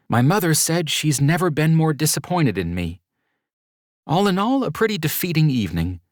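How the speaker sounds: put-on an upset young woman venting about her family; Mercedes, defeated. Mercedes recounts her bad night.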